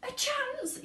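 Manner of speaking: very high incredulous yell; a mother yelling in disbelief. A narrator's reading. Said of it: "yelled Missus Salt".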